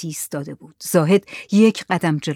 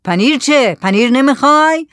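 raised, louder quick offer; shouted questions; 7 semitones higher, 6 dB louder than usual. Acted. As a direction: shout both questions at the stranger. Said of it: "زکریا داد زد". (با داد پرسیدن)

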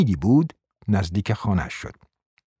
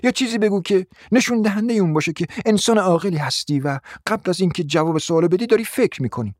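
a scolding wife's higher, sharper voice; bossy wife; 8 semitones higher than usual. A narrator's reading. Wife scolding her meek husband, bossy and sharp. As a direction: lecture him like a domineering wife.

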